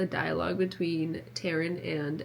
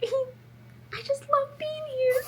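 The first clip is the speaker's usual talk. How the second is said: high, slow and tearful; high, slow, near crying; much slower than usual. Real, not acted. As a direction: choke up with joy. Tearful love of book world.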